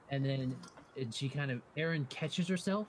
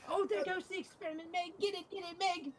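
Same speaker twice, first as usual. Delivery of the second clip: very high squeaky alarm call; Erin, frantic urging. Acted. Erin shrieks high at Meg to catch the escaped experiment.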